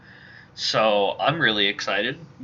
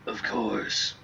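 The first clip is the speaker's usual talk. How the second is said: soft whispered rasp; edgy, raspy; 5 dB quieter than usual. Acted. Masked hero answering the old mercenary, edgy rasp.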